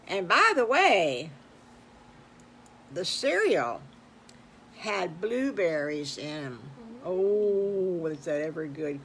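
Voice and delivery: put-on lively read-aloud for children; storytelling voice. A narrator's reading. Host telling the boys and girls a story, animated. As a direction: tell it like a kids' show host.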